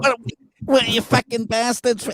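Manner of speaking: high-pitched mock New Zealand accent; put-on New Zealand accent. Host fumbling the show's greeting, in a put-on New Zealand accent. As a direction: slip into swearing in a high Kiwi accent. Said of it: "start acting like a New Zealander".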